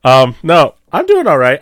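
ordinary talk, nothing marked

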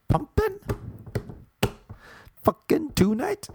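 Pumping rising high as a question; questioning rise. Shown as a demonstration. Host wooing women with a rising question. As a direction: ask it, lifting the end. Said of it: "I do it with a question mark".

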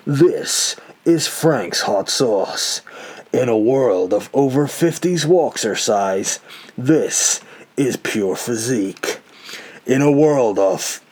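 slow, dramatic trailer announcer; movie trailer voice. Shown as a demonstration. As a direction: epic movie trailer narration. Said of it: "the movie trailer guy's voice".

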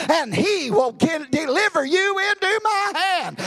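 loud, high, ringing proclamation; defiant sermon cry building to a shout; preaching shout. Retold or imitated. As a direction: hurl the victory at the giant. David's defiant answer, preached.

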